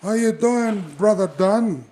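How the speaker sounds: high, loud laid-back wrestler voice; Jimmy Snuka impression. Jimmy Snuka greets Don Morocco before the match, high and loud. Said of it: "I think my conversation would be like this".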